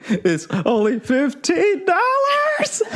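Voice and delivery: high, slow, hammed-up sob; mock crying wail. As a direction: wail it like a fake cry over the bill. Fake crying over dinner bill.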